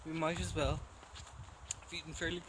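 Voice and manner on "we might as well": ordinary talk, nothing marked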